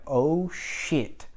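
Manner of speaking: soft, deflated realisation; acted sinking regret, softly said; anger drained away. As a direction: let the rage drop away into quiet dismay. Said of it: "all of the anger just drained away".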